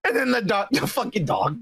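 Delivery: normal talking voice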